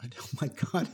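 ordinary delivery

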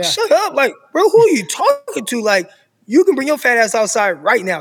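firing back at the coach, daring him outside; angry comeback. Retold or imitated. Senior captain challenging the coach to step outside, high-pitched indignant retort. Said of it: "And I'm like".

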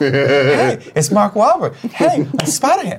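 high-pitched celebrity impression; hammed-up movie-star impression, pitched high; Mark Wahlberg. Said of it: "had a very Mark Wahlberg cadence".

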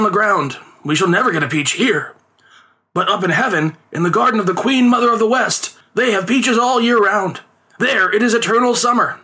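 high, declaimed sudden idea; crying out. A narrator's reading. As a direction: call it out high and theatrical, selling the plan. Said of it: "the father cried out".

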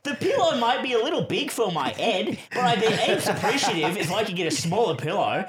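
high, sing-song mock of Josh, laughing; mocking whiny voice. Teasing Josh by parroting his pillow request in a mocking voice. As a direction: needle him by parroting his polite request.

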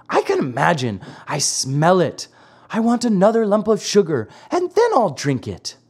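lightly voiced Pinocchio, rising high; wheedling puppet child. A narrator's reading. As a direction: bargain like a spoilt child. Pinocchio bargaining for more sugar.

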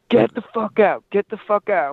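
snapping at drunk strangers, imitated; mock angry yell. Kid behind them at the concert telling them off, mock angry. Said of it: "this kid behind us was like".

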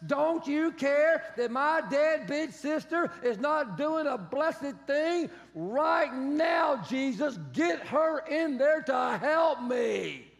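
loud, slow, pleading demands; frantic complaint. Retold or imitated. Sister voiced berating Jesus, loud and slow. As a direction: nag at Jesus like a frazzled host.

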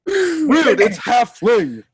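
high, slow, huffy correction; mock offended.

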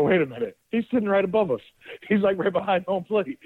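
sudden realization. Retold or imitated. Friends searching for Cookie realize he's right above them, high giddy surprise. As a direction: voice jumps high, giddy with discovery. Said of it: "this tells you how good we were feeling".

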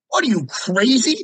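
mock-stunned clerk voice; incredulous clerk. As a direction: imitate the clerk's shocked disbelief. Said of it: "He looks at me, he goes".

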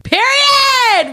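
high, drawn-out cheer; squealed, emphatic sign-off; hyped shout. Pretend. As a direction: whoop it in support of a friend. Listener cheers the telling-off.

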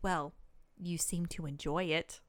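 understated, imitated bitchy comeback. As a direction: drop it low and let it sting. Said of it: "they just get real bitchy".

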